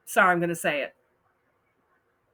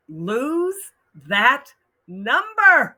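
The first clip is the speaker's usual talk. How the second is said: louder, higher, very slow on that number; emphatic advice; 6 semitones higher, 8 dB louder, much slower than usual. Real, not acted. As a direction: drive home the advice.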